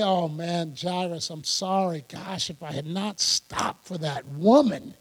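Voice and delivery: low, soft, regretful excuse-making; murmured fake regret, well below normal voice; mock apology. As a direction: play at a sheepish letdown. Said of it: "Jesus doesn't say".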